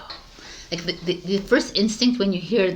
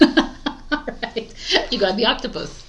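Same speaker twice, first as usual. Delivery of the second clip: ordinary delivery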